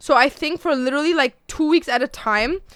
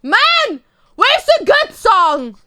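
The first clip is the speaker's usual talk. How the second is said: excited call; 9 semitones higher, 5 dB louder, slower than usual. Real, not acted. Exclaiming while waiting for a song.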